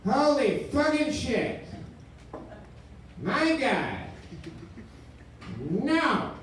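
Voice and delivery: high, drawn-out horrified nos; mock panic. Ghost caller horrified by a Mac without Intel, high wailing. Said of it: "He said on the phone".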